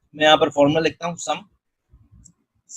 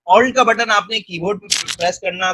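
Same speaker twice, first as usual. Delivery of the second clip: normal talking voice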